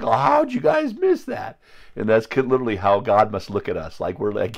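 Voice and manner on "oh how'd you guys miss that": high, slow put-on voice, baffled; Liam's puzzled question. Retelling Liam's dream to the congregation, high baffled put-on voice. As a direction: ask it as if nobody else heard the lion. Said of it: "But he's like".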